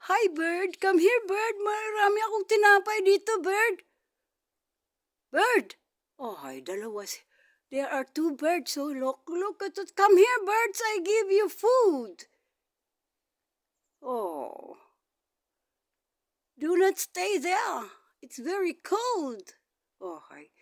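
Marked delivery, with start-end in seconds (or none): 0.1-5.8 high, loud sing-song call to a bird; coaxing a wild bird. Real, not acted. Calling birds to food. 7.7-12.2 high, loud sing-song call to a bird; coaxing a wild bird. Real, not acted. Calling two birds. 16.6-19.6 high, slow coaxing voice; coaxing a wild bird. Real, not acted. Talking to birds in cold.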